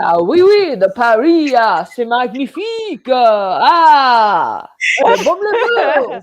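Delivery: hammed-up French with an Italian lilt; bad French accent. Admiring Paris backgrounds on a co-host, badly faked French accent. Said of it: "Sounds like some Italian trying to do a French accent".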